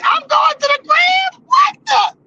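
very high, squeaky mock outrage; hammed-up irritated houseguest; mocking irritated Shannon. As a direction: imitate her shrill disbelief. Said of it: "She was like".

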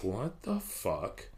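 slow, softly stunned curse; stunned disbelief. Acted. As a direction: draw it out quietly, as if unable to believe it. Ranger stunned by broken woman.

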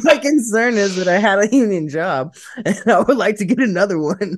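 laughing through the sentence; high giggly voice, can't stop laughing. Real, not acted. Laughing at misunderstanding.

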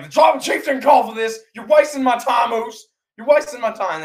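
high, loud shouted tirade; Roman Reigns. Retold or imitated. A fan imitates the champion yelling at the Usos, shouting. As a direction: shout it like an outraged boss. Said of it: "he's, he's shouting".

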